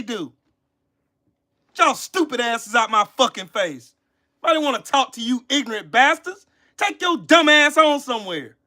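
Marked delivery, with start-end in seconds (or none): none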